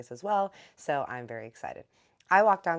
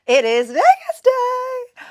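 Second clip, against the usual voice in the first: high, slow, drawn-out shout of joy; a stretched, much higher and louder cheer; excited sing-song shout; 20 dB louder, much slower than usual. Acted. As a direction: belt it out like a big announcement. Announcing the trip day.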